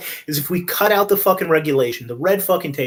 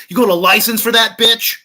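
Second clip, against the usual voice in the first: mock official; 6 semitones higher, 5 dB louder than usual. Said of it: "They're like".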